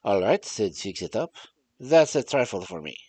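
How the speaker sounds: storytelling in a put-on French-German accent; muddled French-German accent. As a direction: read the tale with a hammy continental accent, French sliding into German. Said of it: "French accent when this is supposed to be German".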